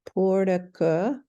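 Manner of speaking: slow "Puraka" with a short u; Puraka slowly. Shown as a demonstration. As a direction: sound out "Puraka" carefully. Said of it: "the little dash that makes the U".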